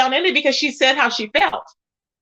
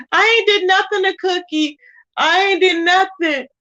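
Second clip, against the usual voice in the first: on the verge of tears; 5 semitones higher than usual. Retold or imitated. Mimicking Brianna protesting to Cookie, near tears, pitched up. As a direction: imitate her pleading like she's about to cry. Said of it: "sound like she wanted to cry".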